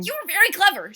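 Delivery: high-pitched storybook reindeer. A narrator's reading. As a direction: voice the reindeer, bright and pleased. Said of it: "said the reindeer".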